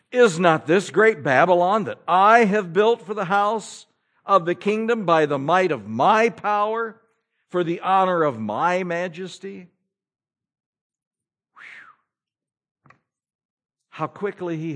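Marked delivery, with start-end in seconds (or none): 0.1-9.7 proud king's boast. Acted. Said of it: "the king spake and said". 11.5-12.9 whistle whew. Real, not acted. Reacts to king's pride.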